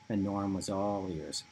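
ordinary delivery, nothing marked

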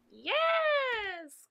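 high, drawn-out yes; witch's joyful cry. A narrator's reading. The witch boarding the new broom, joyful high cry. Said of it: "cried the witch".

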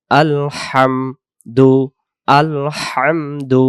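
আলহামদু very slow, the হা breathy from mid-throat; correct recitation. Shown as a demonstration. Teacher modelling the correct reading for students. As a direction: draw out আলহামদু slowly and carefully. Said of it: "উচ্চারণ হবে".

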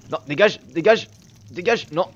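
high, loud bursts of alarm; panicked. Real, not acted. Player caught by the villain, panicked. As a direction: fend the pursuer off in a panic.

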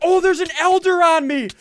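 high, loud cry to the team; urgent alert. Real, not acted. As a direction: call out under sudden attack. Attacked in the game.

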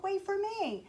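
high pleading call after him. Acted. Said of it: "he called".

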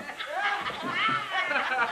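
a burst of high laughter; high-pitched amused laugh. Real, not acted. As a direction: laugh high and breathy at the memory. Laughing at own story.

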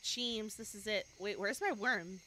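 normal talking voice

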